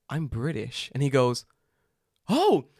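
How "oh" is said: high, loud, drawn-out surprised question; mock surprise. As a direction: imitate a stranger slowly marvelling. Mimicking leaflet man's surprised reply.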